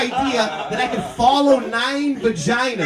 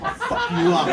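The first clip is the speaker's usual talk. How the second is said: high-pitched mock-angry threat; hammed-up hothead; 11 semitones higher than usual. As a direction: pick a fight in a raised pitch, played for laughs. Acted threat to a stranger.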